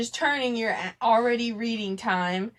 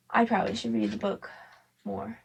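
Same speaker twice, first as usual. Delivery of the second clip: ordinary delivery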